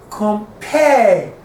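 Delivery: "Compare" with a short "Com" and a long, falling "pare"; stress on PARE. Shown as a demonstration. Teacher marking the stressed syllable, "Compare" stressed on "pare". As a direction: lean on the second syllable of "Compare". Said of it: "the pair is stressed".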